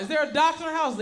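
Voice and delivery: high, loud mock call for help; yelling out. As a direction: call out to a crowd, hammed-up. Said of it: "Somebody yell out".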